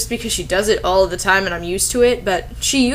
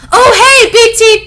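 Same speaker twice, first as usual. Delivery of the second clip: high, loud squealed hey; mock airheaded gush; 13 semitones higher, 10 dB louder than usual. The narrator telling her friend news, mock airheaded squeal. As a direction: squeal it like a ditzy teen girl.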